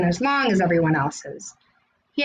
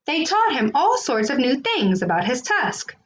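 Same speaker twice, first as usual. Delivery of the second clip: plain reading voice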